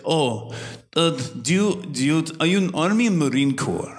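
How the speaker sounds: hesitant questions in a mock Russian accent; older Russian woman. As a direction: imitate a nosy Russian barber. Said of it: "this older Russian, obviously Russian woman".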